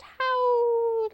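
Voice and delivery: high drawn-out "howled"; howling word. A narrator's reading. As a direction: stretch the word like a hound's howl. Word imitates the hounds' howl.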